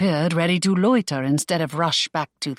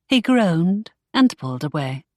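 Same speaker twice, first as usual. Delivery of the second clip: ordinary delivery, nothing marked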